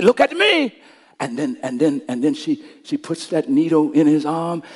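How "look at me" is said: put-on high, raised-voice plea to a child; a father calling his son's eyes to him; urgent command. Father calling his panicking son.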